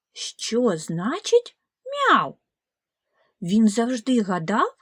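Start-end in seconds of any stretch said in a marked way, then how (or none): 1.8-2.4 a high mock meow; high puzzled meow. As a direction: echo the kitten's meow, puzzled. Goose repeats kitten sound.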